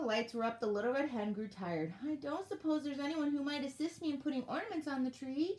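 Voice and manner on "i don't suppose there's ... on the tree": high, pleading elf voice; begging. A narrator's reading. Tired elf asking for help with ornaments, begging. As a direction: plead politely, pitched up. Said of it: "she begged".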